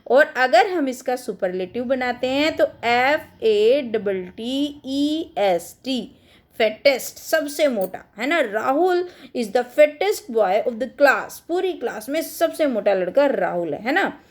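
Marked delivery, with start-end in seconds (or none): 2.8-6.1 letter by letter, slowly; slow spelling out. Shown as a demonstration. Teacher spelling fattest to students, slowly. 8.4-11.4 each word spaced out slowly for the class; slow dictation. Shown as a demonstration. Teacher to her students, slow dictation.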